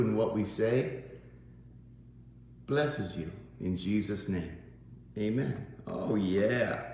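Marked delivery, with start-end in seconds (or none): none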